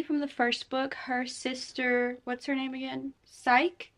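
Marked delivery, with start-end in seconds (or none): 3.3-3.9 Psyche as one syllable, like "sike"; unsure pronunciation. Real, not acted. Vlogger trying to recall the sister's name, Psyche said "sike". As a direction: say Psyche with a silent final e, rising. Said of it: "Is it Psyche or Psyche?".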